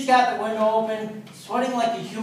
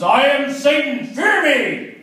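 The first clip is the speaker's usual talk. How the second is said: higher, louder, slow devil roar; mock demon roar, louder the second time; Satan voice; 6 semitones higher, 7 dB louder, slower than usual. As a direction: bellow it like a hammy devil. Satan repeats his demand.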